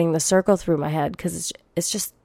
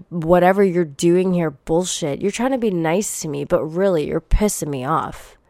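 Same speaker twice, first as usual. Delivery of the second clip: usual speaking voice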